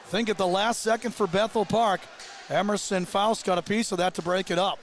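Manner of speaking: usual speaking voice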